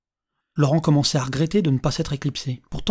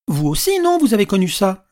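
tossed-out question; 5 semitones higher, 6 dB louder than usual. A narrator's reading. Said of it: "lança-t-il à la vieille dame".

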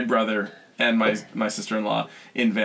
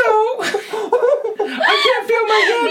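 laughing hard; 4 dB louder, much slower than usual. Real, not acted. Laughing fit retelling.

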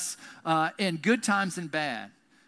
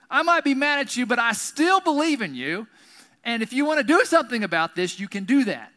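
raised, emphatic voice, mad yet believing; insistent pleading; 6 semitones higher, 6 dB louder than usual. Acted. Martha still believing though mad at Jesus, insistent pleading. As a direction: press the point, louder and higher.

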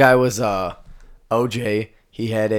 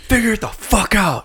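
mock yell; 10 semitones higher, 5 dB louder than usual. Mocking line-blockers at exit.

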